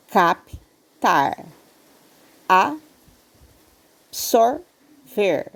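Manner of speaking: "absorver" drawn out syllable by syllable; slow syllables. Shown as a demonstration. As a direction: stretch "absorver" slowly into its syllables. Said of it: "vamos separar elas em sílabas". ("absorver" bem devagar, sílaba por sílaba)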